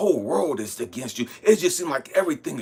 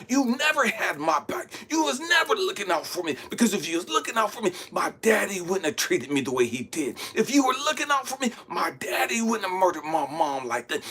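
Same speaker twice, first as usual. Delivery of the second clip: high, aggrieved tirade; angry at God; 4 semitones higher than usual. Retold or imitated. Man blaming God for never looking out for him, angry. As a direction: pour out angry accusations, pitch jumping up. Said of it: "Because I'm so angry at God".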